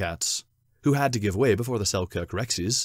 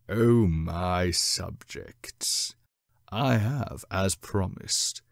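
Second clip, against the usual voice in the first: deep cat king; slower than usual. Acted. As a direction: rule over your subjects, slow and solemn. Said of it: "His voice rang deep".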